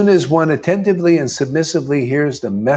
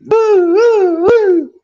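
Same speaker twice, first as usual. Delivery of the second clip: high, loud yee-haw whoops; cowboy whoop; 6 dB louder, much slower than usual. Shown as a demonstration. Preacher showing how to wave the truth around, loud cowboy whoops. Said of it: "like on a horse, these cowboys".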